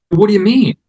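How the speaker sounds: high, baffled retort; incredulous question. Retold or imitated. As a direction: act out not believing what you heard. Retold baffled reply to tech.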